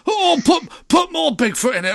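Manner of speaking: high, loud hammed-up producer demand; Northern producer impression. Retelling the producers' demand, a loud high mock Northern voice. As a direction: squeal it like a giddy Northern producer. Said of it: "the producers were Northern".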